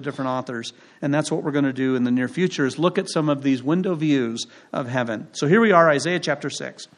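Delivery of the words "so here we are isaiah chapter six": ordinary talk, nothing marked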